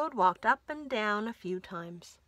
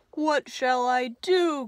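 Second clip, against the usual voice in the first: louder, despairing cry; 3 semitones higher, 10 dB louder than usual. Acted. Toad crying out over his seeds, louder. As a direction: wail it out in despair. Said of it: "cried Toad".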